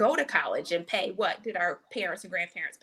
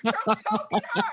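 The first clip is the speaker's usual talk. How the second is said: slow words through loud laughter; laughing; 5 dB louder, much slower than usual. Real, not acted. Host recalling the walker lady, laughing hard.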